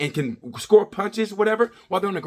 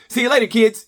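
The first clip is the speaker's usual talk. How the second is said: breezy mock farewell, higher and louder; deadbeat dad leaving; 6 semitones higher, 5 dB louder than usual. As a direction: toss it off like a dad walking out. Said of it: "like a deadbeat dad on Christmas Eve".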